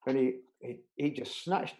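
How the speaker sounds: normal talking voice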